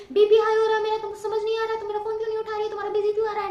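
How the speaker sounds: hammed-up yelling of an angry boyfriend; imitating angry shout. Mimicking the boyfriend yelling about her busy phone, loud and mocking. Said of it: "And he was like कि".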